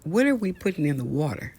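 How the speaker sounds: normal talking voice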